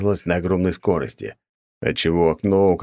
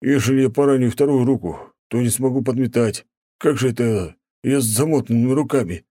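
higher, pained, a touch of a groan; moaning plea; 6 semitones higher than usual. A narrator's reading. Old janitor groaning out his plea to the viscount. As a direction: whine it as a frightened old servant. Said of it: "простонал дворник".

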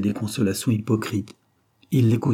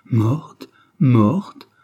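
stunned cry, louder on the repeat; grief-struck outcry with mild force; anguished exclamation; 3 semitones higher, 5 dB louder than usual. A narrator's reading. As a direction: exclaim it in disbelief, rising at the end. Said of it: "s'écria-t-il".

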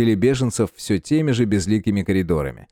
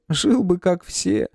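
high lament; 9 semitones higher, slower than usual. A narrator's reading. Nikolai laments.

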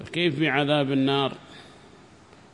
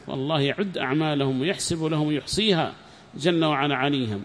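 normal talking voice

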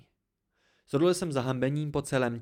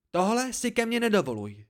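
high, indignant warning; angry warning; 10 semitones higher, 4 dB louder than usual. A narrator's reading. Dena points a finger at the narrator, angry warning. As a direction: warn them off sharply. Dena warns him off angrily.

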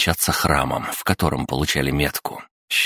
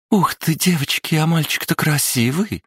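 put-on much higher, gushing girlish squeal; excited blurting blonde; 13 semitones higher than usual. A narrator's reading. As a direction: gush over the cute boy to her friends. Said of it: "восторженно выпалила блондинка". (восторженный девичий визг)

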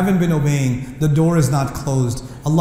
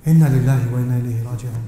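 lower, quick, broken murmur; tearful recitation; 6 semitones lower, faster than usual. Retold or imitated. A crying brother mourning his recitation, tearful. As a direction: mutter it through tears.

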